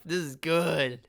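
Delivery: normal talking voice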